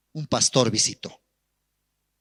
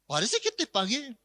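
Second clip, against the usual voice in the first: put-on drunk young man; 9 semitones higher, 5 dB quieter than usual. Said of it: "El, este joven borracho".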